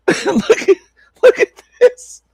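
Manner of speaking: high, wheezing look at this; pointing at the screen, cracking up; laughing squeal. Real, not acted. Laughing at the video.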